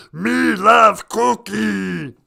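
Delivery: loud, low, drawn-out monster voice; big growly puppet monster; Cookie Monster. Acted. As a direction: bellow it slow and deep. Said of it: "This is Cookie Monster".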